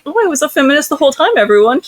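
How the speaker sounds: high, fast mocking voice; high-pitched mock declaration; mock self-righteous character. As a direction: rattle it off high, as a mock proud announcement. Mocking a book character's claim.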